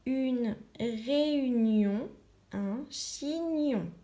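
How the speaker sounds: une réunion, un chignon read very slowly, each syllable drawn out; slow careful reading. Shown as a demonstration. Teacher reading slowly so the class hears the gne sound. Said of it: "on entend gne".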